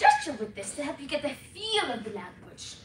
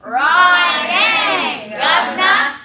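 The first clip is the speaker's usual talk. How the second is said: loud, higher Cockney in unison; Cockney accent; 6 semitones higher, 14 dB louder, much slower than usual. Shown as a demonstration. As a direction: bellow it in drawn-out Cockney. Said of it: "in a Cockney accent".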